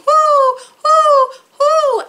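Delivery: high, loud drawn-out hooting; shouted owl hoots. A narrator's reading. Storyteller voices the shouting little owl, high loud hoots. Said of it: "He was shouting".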